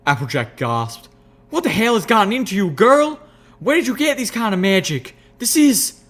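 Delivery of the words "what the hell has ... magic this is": loud, high, fast alarmed country voice; alarmed outburst. Acted. Applejack horrified at necromancy, loud fast alarmed voice.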